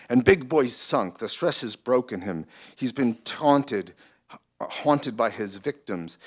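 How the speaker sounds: lightly voiced Brooklyn producer; Brooklyn accent. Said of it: "I always make it a Brooklyn voice".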